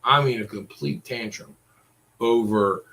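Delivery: usual speaking voice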